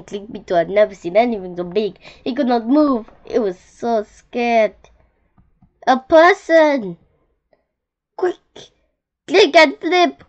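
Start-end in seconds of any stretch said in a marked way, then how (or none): 5.9-10.2 loud, high, urgent warning; urgent cry. A narrator's reading. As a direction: shout the alarm to the young beetle. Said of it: "cried the wise old click beetle".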